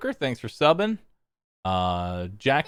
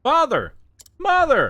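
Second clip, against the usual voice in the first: calling out; 9 semitones higher, 3 dB louder than usual. Acted. Reading a game ending aloud.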